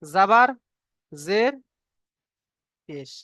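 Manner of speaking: each word set apart with long pauses; name each mark slowly for the class; spaced-out teacher's roll call; slow deliberate naming. Shown as a demonstration. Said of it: "আমি এখানে বুঝিয়ে দিচ্ছি".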